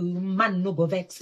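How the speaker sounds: usual speaking voice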